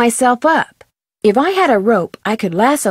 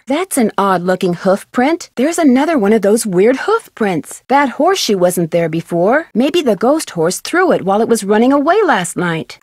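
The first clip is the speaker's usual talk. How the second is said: ordinary talk, nothing marked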